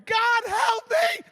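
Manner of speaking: high, drawn-out mock wail at full volume; mortified shout. Grumpy driver spotted by church member, mortified shout. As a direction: shout it slowly, hammed-up desperation.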